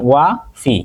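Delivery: ওয়াফি split as "wa, fi" with a small gap; two separate syllables. Shown as a demonstration. Teacher showing a name is double syllable, ওয়াফি split in two. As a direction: break ওয়াফি into two beats, wa and fi. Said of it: "ওয়াফির নাম হচ্ছে double syllable".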